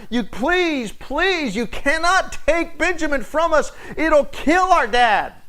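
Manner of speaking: high, pleading plea, slowing at the end; mock desperate begging, pitched high; begging Judah. Judah begging Joseph to spare Benjamin, high and desperate. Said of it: "pleading and begging".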